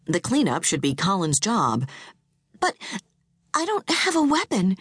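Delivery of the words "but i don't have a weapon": frightened. A narrator's reading. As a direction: plead that you can't. Frightened wife protests.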